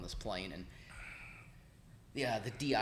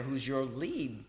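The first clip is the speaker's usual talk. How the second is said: chuckling; 5 semitones higher, 4 dB louder, much slower than usual. Retold or imitated. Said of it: "I said".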